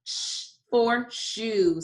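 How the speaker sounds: slow, sh held long before shoe. Shown as a demonstration. Teacher modelling the sound slowly for the class. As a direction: stretch the sh in shoe. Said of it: "Say it with me".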